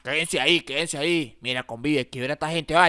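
low, menacing robber voice. Acted. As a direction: growl it like a street thug. Asaltante da órdenes. (voz grave de atracador)

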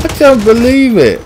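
high, loud cry of disbelief; disbelieving outcry. Real, not acted. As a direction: wail it, stunned. Disbelief at getting killed.

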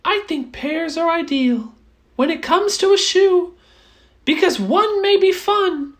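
high mouse voice, a touch disappointed; a small mouse turning down a crowded shoe; little mouse voice. Acted. Mouse rejecting the shoe, high and mildly let down. Mouse sums up his search.

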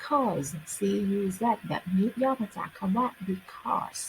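ordinary delivery

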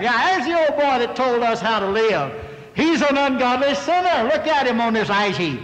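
high-pitched taunting jeer; jeering mockery. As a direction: jeer at a fallen man like young bucks passing by. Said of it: "coming by and laughing at him".

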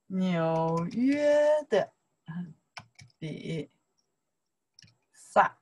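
纽约的 stretched out very slowly, then 比 and 萨 apart; teacher's slow drill of 纽约的比萨; slow drilled syllables. Shown as a demonstration. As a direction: model each syllable slowly for students. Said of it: "纽, okay".

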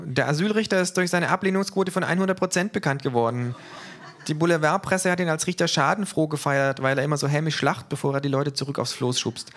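the kangaroo gossiping at the book award. A narrator's reading. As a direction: tell it gleefully as the cheeky kangaroo. Kangaroo voice in reading.

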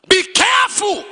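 shouted warning. Real, not acted. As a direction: cry out a warning to the congregation. Preacher warns congregation.

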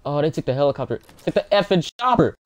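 outraged shout. Real, not acted. Helicopter stolen in game.

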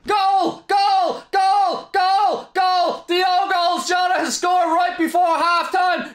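screamed goal call; goal celebration shout. Real, not acted. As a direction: scream it, ecstatic.

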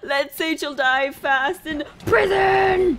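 drawn out, rising to a cry; hammed-up slow proclamation; mock tragic lament. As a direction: wail theatrically at cruel fate. Mock lament over game death.

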